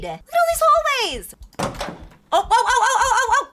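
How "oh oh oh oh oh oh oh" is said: normal talking voice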